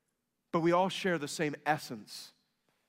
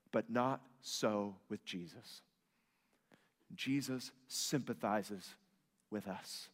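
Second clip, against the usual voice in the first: slow, soft and low; tender sermon; 4 semitones lower, 6 dB quieter, slower than usual. Real, not acted. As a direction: let it settle gently. Slow soft preaching moment.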